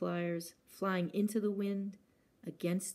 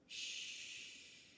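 breathy hushing shh like wind; gusting windy whisper; 15 dB quieter than usual. Acted. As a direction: hush it like a breeze at the window. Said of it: "whispered the wind".

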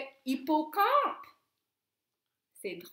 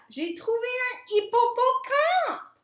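excited; 9 semitones higher, 8 dB louder than usual. A narrator's reading. Stella excited by her find.

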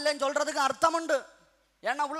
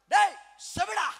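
sudden high call, louder than the lead-in; blunt direct address; 6 semitones higher, 4 dB louder than usual. Acted. Preacher acting out being directly called deaf, sudden shout. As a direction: shout it straight at one person. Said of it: "டைரக்டா என்ன பார்த்து".

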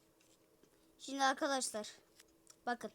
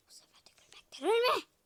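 whisper; 7 semitones higher, 4 dB louder than usual. Pretend. Whispered line.

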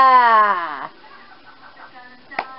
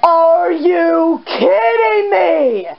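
slow, drawn-out amazement; stunned disbelief; slower than usual. Real, not acted. Gamer reacting to the finale, slow stunned disbelief. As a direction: stretch each word out in real disbelief.

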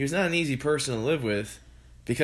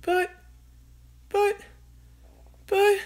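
high, halting mother's voice; stammering mother; 4 dB louder, slower than usual. A narrator's reading. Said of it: "stammered George's mother".

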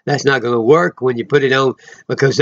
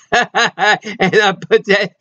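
laughing; 6 semitones higher, much slower than usual. Real, not acted. Laughing while speaking.

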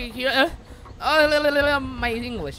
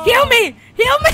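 high, loud pleading yell; desperate shout; 14 semitones higher, 12 dB louder than usual. Real, not acted. Player begging teammate to heal, high shout. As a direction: scream for a teammate to heal you.

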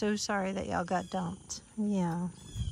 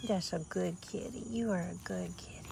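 soft praise; 2 semitones lower, 6 dB quieter than usual. Real, not acted. Praising kitten softly.

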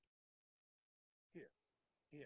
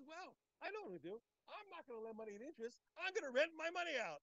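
higher, louder, pleased with himself; voicing Rav Chama's scheme; 9 semitones higher, 10 dB louder than usual. Rav Chama boasts his plan to the class, higher and louder. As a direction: play the clever schemer proudly announcing his plan. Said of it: "He said".

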